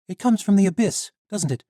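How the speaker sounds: put-on high, loud young heroine; defiant young woman. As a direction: stand up to the guard. Misha accuses the knight.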